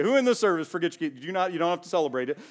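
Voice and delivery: ordinary talk, nothing marked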